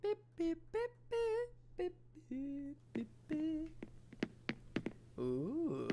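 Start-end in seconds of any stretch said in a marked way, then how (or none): 0.0-3.7 playful beeping noise; high mock beeping. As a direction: beep beep in a high squeaky voice. Imitating a beeping sound.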